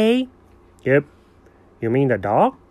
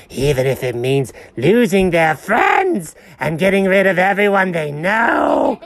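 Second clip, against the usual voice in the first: ordinary talk, nothing marked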